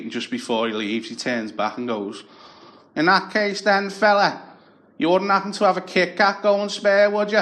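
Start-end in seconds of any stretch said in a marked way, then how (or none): 3.0-7.4 high, chummy wheedling Scouse beggar; scrounger's voice. Retold or imitated. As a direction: sweet-talk the neighbour for a favour. Tony's parting request.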